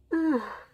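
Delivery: a loud, high yawn that breaks into a sigh; stretched-out yawn of a sleepy streamer; big yawn. Real, not acted. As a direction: let a huge tired yawn out. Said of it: "That yawn hit me super hard".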